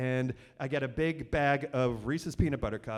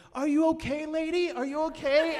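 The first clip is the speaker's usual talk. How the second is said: put-on high, childlike voice, a touch laughing; little kids' voices; 10 semitones higher, 4 dB louder than usual. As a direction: play the little trick-or-treaters. Kids voiced in a retold story.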